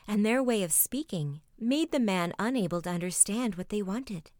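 usual speaking voice

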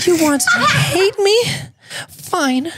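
high put-on woman voice, laughing; woman's voice. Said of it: "so I gotta go into a girl's voice here".

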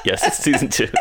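giggling through the words; laughing. Real, not acted. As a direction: laugh while saying it.

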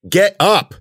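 high, loud command; urgent yell. Acted. Cece urges fallen Ben to flee, urgent shout. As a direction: shout it to get him moving. Urging injured friend to flee.

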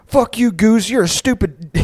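high-pitched mock drunken holler; letting it rip. Drunk friend cursing the goose, high mock yell. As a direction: imitate a friend hurling insults across water. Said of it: "just lets it rip".

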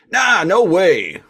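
high, loud, slow put-on Italian-American accent; a plumber-brother Italian-American accent for Wheeljack. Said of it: "I can't do his accent".

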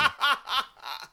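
high manic cackle; hysterical laugh. Real, not acted. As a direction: burst into a maniacal laugh. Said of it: "Does anyone have a better maniacal laugh?".

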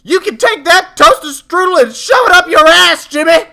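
exasperated mom at full bellow; Jimmy's mom. Acted. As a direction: explode slowly and very loudly at the whining kid. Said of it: "she's had a long day".

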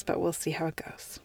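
soft breathy aside, close to crying; real tears held back under a whisper; near-whisper, fighting tears. Real, not acted. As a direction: drop to a shaky hush. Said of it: "try my best not to cry".

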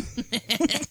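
soft breathy giggle; little tickled laugh; quiet laugh. Real, not acted. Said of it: "I love when [name] does that laugh".

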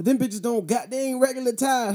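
ordinary delivery

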